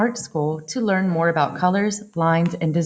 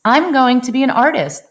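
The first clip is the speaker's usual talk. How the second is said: proud declaration; 5 semitones higher, 4 dB louder than usual. A narrator's reading. Boy declaring his dream.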